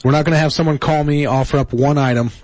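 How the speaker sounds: ordinary talk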